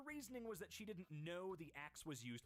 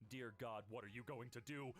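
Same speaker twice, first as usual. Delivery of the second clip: ordinary talk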